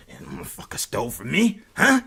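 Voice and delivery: put-on voice of someone getting riled; imitated pumped-up reply. Goaded roommate getting pumped up, put-on riled voice. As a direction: let the goading work, in a mock riled voice.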